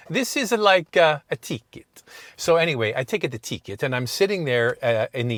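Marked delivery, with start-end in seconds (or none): 0.1-1.9 higher and louder Italian clerk, halting; Italian accent. Retold or imitated. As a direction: replay the clerk's broken English with relish. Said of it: "it sounded better when she says".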